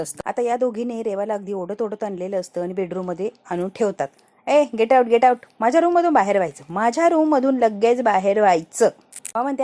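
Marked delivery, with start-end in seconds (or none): 4.5-8.9 loud, insistent command to leave; angry order. Acted. Drunk Reva orders the two women out of her room, loud and angry. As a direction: throw them out with a raised voice.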